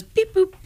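very high little beep-boop; imitated beep boop. Imitating co-host typing on his phone, high beep boop. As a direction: chirp it as a vocal sound effect. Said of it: "he goes".

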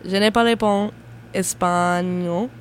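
espagnol drawn out slowly, French vowels; careful French. Shown as a demonstration. Second chance live on air, slow careful French. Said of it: "Let me actually try to say".

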